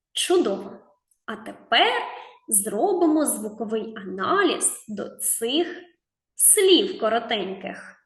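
ordinary talk

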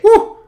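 very high, loud "uh"; sudden squealed hoot; excited whoop. Real, not acted. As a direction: whoop at a narrow success. Narrowly makes a jump.

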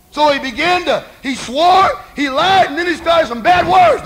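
high, very loud sermon shout; thundering preacher telling the story; full-force preaching. Real, not acted. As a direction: build the list in shouted bursts.

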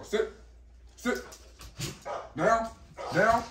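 high, rising "Down" command; sing-song order to the dog. Real, not acted.

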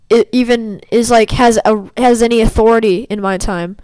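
ordinary delivery

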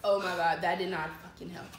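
usual speaking voice

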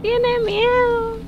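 Tiene miedo said very high and slow; mocking high voice. Real, not acted. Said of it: "repeated phrase".

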